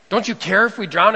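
loud, frightened demand; panicked plea. Retold or imitated. Frightened disciples waking Jesus in the storm, loud panicked plea. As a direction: shout it over the storm in panic.

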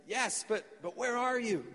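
high, searching acted question; puzzled calling out. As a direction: call up to someone you can't see, pitched high. Jack calls to unseen voice.

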